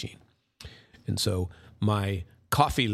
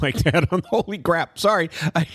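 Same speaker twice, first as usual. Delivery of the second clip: laughing; 8 semitones higher, 5 dB louder than usual. Real, not acted. Laughs at his own crude remark.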